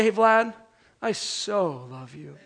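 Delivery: hammed-up gushing at a buddy; mock tender "so". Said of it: "even more different if I were to say".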